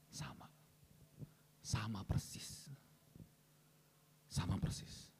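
hushed, drawn-out whisper; emphatic whisper. Real, not acted. Preacher tells congregation the percentage is identical, emphatic whisper. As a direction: let the point land in a slow whisper.

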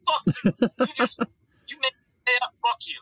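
high, playful cursing; mock anger. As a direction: curse him out playfully. Retold cursing at Morgan.

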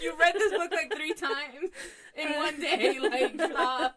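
mock telling-off through laughter; exasperated mom. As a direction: lightly imitate a fed-up mom. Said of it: "My mom's like".

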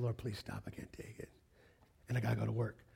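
low murmur sinking into a whisper; overwhelmed hushed prayer. Retold or imitated. Overwhelmed man praying to the Lord, hushed plea. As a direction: plead very softly, voice dropping away.

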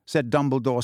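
plain reading voice

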